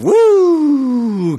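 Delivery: high, loud drawn-out siren wail; one long rising-then-falling mock siren; imitated police siren. Imitating police siren pulling him over.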